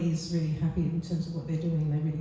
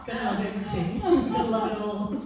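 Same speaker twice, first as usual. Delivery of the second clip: laughing through the words; giggling, a little higher; 4 semitones higher than usual. Real, not acted. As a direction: chuckle out the joke about loving it all. Laughing at her own radiation joke.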